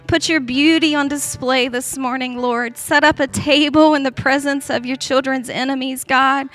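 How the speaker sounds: heartfelt prayer, rising in pitch as devotion builds; fervent prayer. Real, not acted. Woman leading the room in prayer to Jesus, fervent and building.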